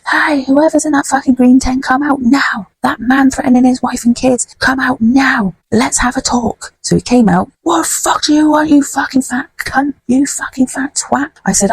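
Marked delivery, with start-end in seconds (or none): 0.1-6.7 high, insistent call without full volume; re-enacted calling out. Calling man out of tent. 7.6-11.3 imitating angry man. Man insults her, retold confrontation.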